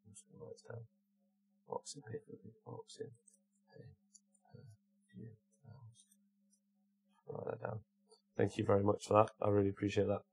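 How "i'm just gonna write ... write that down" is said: barely audible, talking to yourself as you jot; muttering to self. Real, not acted. Streamer noting down a viewer's tip, muttering to self. Talks to self while writing it down.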